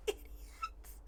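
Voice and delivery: breathy whispered scorn; whispered insult. Real, not acted. Player mutters at the guards, whispered scorn. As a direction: spit it out under your breath.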